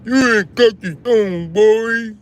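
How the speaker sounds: high, loud taunting caricature; mock voice. Streamer taunting a chatter in a high mock voice. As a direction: sneer it like a cocky kid. Mocking a chat commenter.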